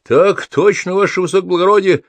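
high, quick parade-ground bark; eager yes-sir, pitched right up; barked military reply. A narrator's reading. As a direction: snap it out like a soldier to a superior. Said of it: "гаркнул Форосков".

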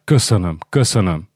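usual speaking voice

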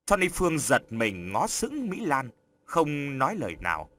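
usual speaking voice

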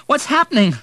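high, loud helpless cry. A narrator's reading. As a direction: ask in helpless panic. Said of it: "said William helplessly".

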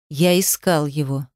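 low, slow male reply; hard, irritated. A narrator's reading. Demyan insists he searched, hard and irritated. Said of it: "в голосе его появилась жёсткость".

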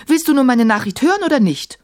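high-pitched, quick impatient demand. A narrator's reading. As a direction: press for an answer, losing patience. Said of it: "So langsam verlor sie die Geduld".

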